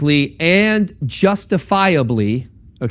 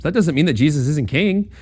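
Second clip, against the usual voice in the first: normal talking voice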